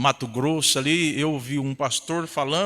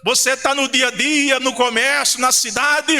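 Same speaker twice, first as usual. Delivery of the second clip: higher-pitched, driving preacher's delivery; pregação fervorosa; 6 semitones higher, 3 dB louder than usual. Real, not acted. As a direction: preach it with mounting fervour to the congregation. Preaching at full force. (pregação inflamada)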